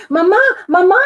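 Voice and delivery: high, loud urgent calling; child calling Mama in a panic; gasped. Acted. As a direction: cry out for mother, breathless. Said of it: "he gasped".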